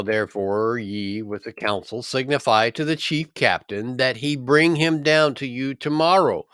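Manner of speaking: ordinary delivery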